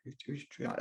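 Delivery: "Utrecht" said tentatively and very softly, with a false start; unsure pronunciation. Real, not acted. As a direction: fumble quietly at the foreign name "Utrecht". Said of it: "I don't know if that's how you say".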